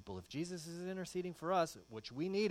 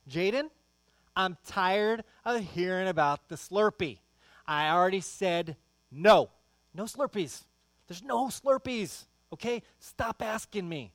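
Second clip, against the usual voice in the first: loud, high fed-up dad; mock-furious father shouting; exasperated yelling; 5 semitones higher, 13 dB louder than usual. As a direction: let the frustration explode at the kid. Dad scolding son in car.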